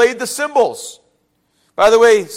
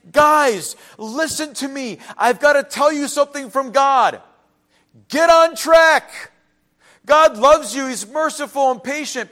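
pleading, pitched a little higher; urgent prophet plea; 5 semitones higher than usual. Retold or imitated. Prophet urging God's people, earnest plea. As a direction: urge the people to turn back. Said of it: "And they're saying".

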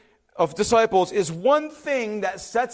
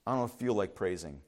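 much lower, much softer sulky line; reluctant mopey voice; 13 semitones lower, 10 dB quieter than usual. Retold or imitated. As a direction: mumble it like someone unwilling. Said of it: "voicing a reluctant congregant".

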